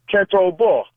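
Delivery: normal talking voice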